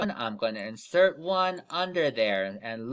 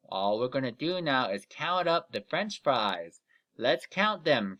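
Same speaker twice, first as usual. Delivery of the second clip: normal talking voice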